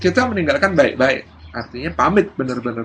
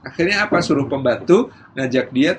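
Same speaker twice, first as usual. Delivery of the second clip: normal talking voice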